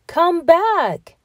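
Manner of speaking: put-on high calling voice of the old man. A narrator's reading. Old man calls after the gingerbread man, high and loud. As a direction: call after the runaway. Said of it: "said the little old man".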